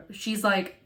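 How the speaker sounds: normal talking voice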